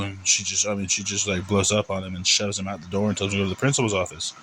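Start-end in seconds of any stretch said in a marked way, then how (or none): none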